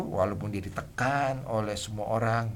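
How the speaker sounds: ordinary talk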